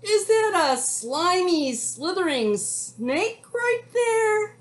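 high, loud panic, drawing out the end; scared cowboy. A narrator's reading. Frightened cowboy spots a snake, high loud alarm. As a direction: cry out in exaggerated alarm. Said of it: "He's scared again".

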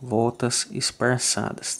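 ordinary talk

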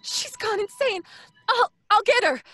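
ordinary talk, nothing marked

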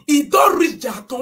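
indignant shout; angry repeat. Real, not acted. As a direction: hammer it home. Repeated louder in anger.

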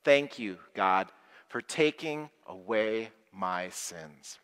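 slow lead-along; slow group recital. Shown as a demonstration. Leading children in a thank-you prayer, slow. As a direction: say it slowly so everyone can join in. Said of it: "Say that with me".

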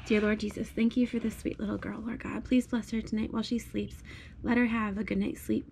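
soft, hushed prayer voice; bedtime prayer. Real, not acted. Mom prays over her little girl at bedtime, hushed prayer.

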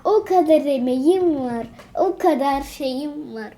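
ordinary talk

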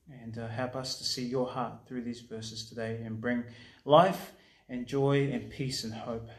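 soft, low, reverent praying; prayer. Real, not acted. Pastor praying over the church before the word, softly prayerful.

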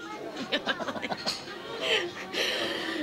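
sudden high burst of laughter. Real, not acted. Telling friends a story, laughing high. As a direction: laugh helplessly, high and breathy.

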